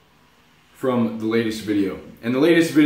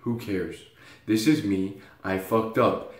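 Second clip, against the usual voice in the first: softer, lower, shrugging it off; quiet self-acceptance; 4 semitones lower, 5 dB quieter than usual. Retold or imitated. Telling himself it's fine after misspelling, quietly. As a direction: say it to yourself quietly. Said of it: "it was like".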